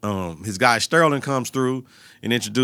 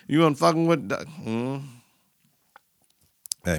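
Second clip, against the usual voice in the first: ordinary talk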